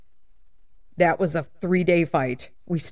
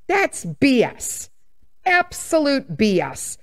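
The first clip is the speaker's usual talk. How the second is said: slow, higher, each letter of "B S" spelled out; emphatic scorn; 4 semitones higher, slower than usual. Real, not acted. Dismissing couples who won't marry, slow emphatic "B S". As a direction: drag out "B S" with scorn.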